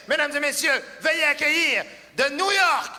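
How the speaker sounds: high, loud hyped introduction; arena announcer. Acted. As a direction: hype the next act like a stadium host. Introduces next act.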